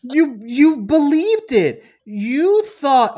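high, loud, laughing mock scolding; mocking disbelief. Mocking fans who believed the rumor, high laughing scold. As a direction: laugh at them while scolding them, slow and high. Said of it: "And I was like".

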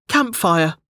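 high-pitched, mild call. A narrator's reading. Delta orders from the menu, high calling voice. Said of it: "she called".

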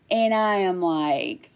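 ordinary talk

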